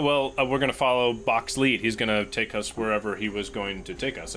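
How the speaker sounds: ordinary talk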